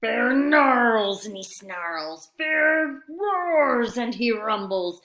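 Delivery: usual speaking voice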